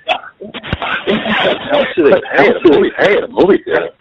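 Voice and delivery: giggling through the question; laughing. Real, not acted. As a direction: laugh while asking. Laughing question about pay.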